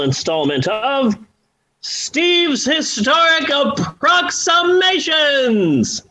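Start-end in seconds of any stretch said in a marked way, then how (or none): 1.8-6.0 slow, drawn-out storyteller title; fireside storytelling. Acted. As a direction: announce it like a campfire tale. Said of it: "reword it via my own unique storytelling voice".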